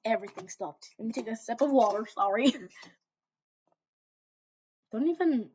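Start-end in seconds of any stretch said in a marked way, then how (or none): none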